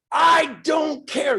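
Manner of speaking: loud, slow, high defiant yell; a bold, fearless shout. Acted. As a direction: shout it like nothing can stop you. Acting bold mindset.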